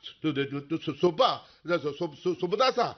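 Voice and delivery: frightened stammer. Acted. Scared, sees a ghost.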